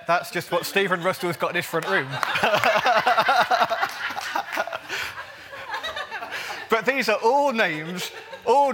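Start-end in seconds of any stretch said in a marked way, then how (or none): 2.1-6.7 hearty laugh. Real, not acted. Laughs at his own joke.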